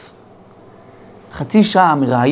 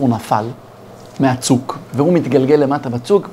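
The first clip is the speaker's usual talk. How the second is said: ordinary delivery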